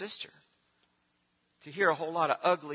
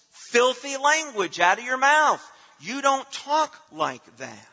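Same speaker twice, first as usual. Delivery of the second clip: higher, louder, driving sermon voice; preaching at full force; 7 semitones higher, 7 dB louder than usual. Acted. Pastor exhorting the congregation, preaching hard. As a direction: press each point home from the pulpit.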